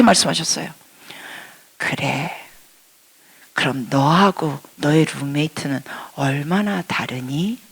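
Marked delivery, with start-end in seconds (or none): 1.8-7.6 soft, gentle asking voice; God gently questioning; very kind. Retold or imitated. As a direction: speak softly like a kind friend. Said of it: "너무너무 친절하게 말씀하십니다".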